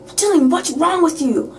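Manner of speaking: high-pitched, indignant mock outburst; angry confrontation. Alina storms in on Dylan, imitated angry scolding. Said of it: "Alina stormed in".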